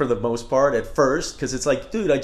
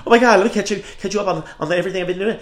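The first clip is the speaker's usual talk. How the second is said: louder, higher, fast gushing catch-up; mock breathless over-sharing to a friend; overexcited rush; 6 semitones higher, 4 dB louder, much faster than usual. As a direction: blurt it all out at once.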